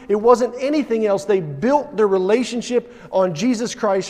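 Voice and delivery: rising sermon fervor, pounding the repeats; full-force preaching. Real, not acted. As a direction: preach it hard, building the list.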